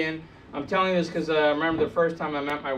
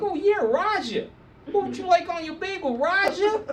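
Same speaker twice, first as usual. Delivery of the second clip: high-pitched Brooklyn shopkeeper impression; Brooklyn accent; 12 semitones higher than usual. Bagel shop owner taking an order, Brooklyn accent. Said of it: "saying Roger with a Brooklyn accent".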